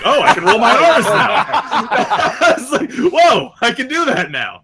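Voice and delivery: excited. Retold or imitated. Retold delight at rolling R's.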